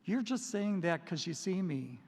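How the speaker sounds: usual speaking voice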